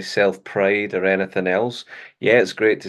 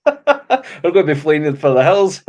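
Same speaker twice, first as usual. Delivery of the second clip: laughing; 7 semitones higher, 6 dB louder than usual. Real, not acted. Laughing joke about fleeing.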